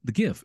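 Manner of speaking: "gif" said with a hard g, as in graphic. Shown as a demonstration. Host arguing from the word graphic, hard-g "gif". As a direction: start "gif" on a hard g sound. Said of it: "the G stands for graphic".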